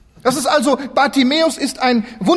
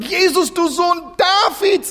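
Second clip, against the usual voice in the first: crying out; 7 semitones higher, slower than usual. Acted. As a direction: call out desperately to Jesus. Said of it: "so schreit er es hinaus".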